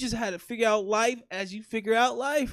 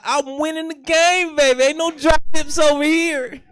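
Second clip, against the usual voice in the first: loud, raised-pitch mock triumph; cocky retold boast; 4 semitones higher, 7 dB louder than usual. Boy boasting after first smooch, loud mock triumph. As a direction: crow it like a hammed-up champion.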